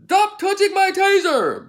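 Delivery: high, loud barked commands from a cop; mock cop yell, high and loud; imitating cop yelling. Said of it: "You heard the cop yelling".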